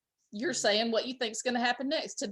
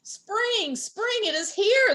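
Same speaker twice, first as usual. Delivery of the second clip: high, louder, slow shout; 10 semitones higher, 3 dB louder, slower than usual. A narrator's reading. Groundhog shouting in the pig's ear. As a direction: yell it right in his ear. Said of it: "shouted loudly in the pig's ear".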